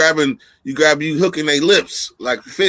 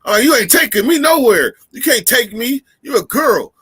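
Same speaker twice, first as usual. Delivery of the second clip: mock cocky retort; 6 semitones higher than usual. Retold reply to girl's challenge.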